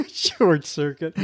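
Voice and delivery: laughing through the words, slowed; amused chuckle around the phrase; slowly repeating it while laughing. Real, not acted. Laughing at host's pun.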